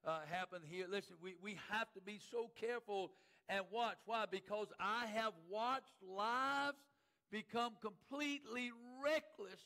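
loud, urgent preacher's warning; forceful preaching. Real, not acted. Preacher warning church of lives gone reckless, forceful preaching. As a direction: press each phrase home, stretching the last words.

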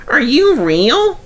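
high, eager interruption; bursting out. Acted. Peter Sam bursts out at the Duke, high and eager. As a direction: blurt it out, unable to hold back. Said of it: "he burst out".

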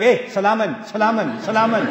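brisk, repeated "salaman" thrown at an opponent; mock combative greeting, repeated insistently; confrontational greeting. Shown as a demonstration. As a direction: fire off the greeting as if mid-fight. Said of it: "Not like that".

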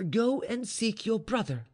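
high-pitched, decided order; regained resolution. A narrator's reading. Mistress sends maid for her brother, resolute. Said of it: "recovering her usual resolution".

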